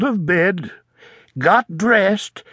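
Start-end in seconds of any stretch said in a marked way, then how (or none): none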